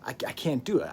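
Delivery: ordinary talk, nothing marked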